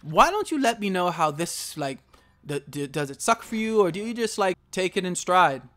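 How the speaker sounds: ordinary talk, nothing marked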